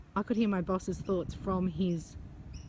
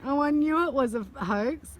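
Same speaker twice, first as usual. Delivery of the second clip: high, loud and tickled, just out of a laugh; amused, raised voice after a laughing fit; giggly; 7 semitones higher, 5 dB louder than usual. Real, not acted. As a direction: blurt it out through the giggles. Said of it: "then I got the giggles".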